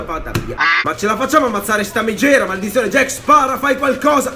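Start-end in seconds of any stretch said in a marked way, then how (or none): 2.9-4.3 loud, high desperate order; real desperate yell; urgent shout. Real, not acted. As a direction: shout at Jack to shoot. Yells at teammate Jack.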